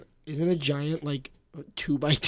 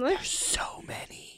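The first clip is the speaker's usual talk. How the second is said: awed whisper; 12 semitones higher, 4 dB quieter than usual. Real, not acted. Awed at many snakes.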